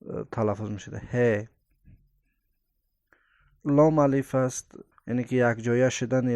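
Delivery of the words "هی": "هی" with a light h carried on the outgoing breath. Shown as a demonstration. Teacher showing the light letter, breathed "هی". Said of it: "در ردیف نفس برآمدن".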